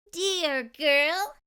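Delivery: put-on a magical fairy addressing the girl kindly. Fairy greeting the girl, high magical voice. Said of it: "said the fairy".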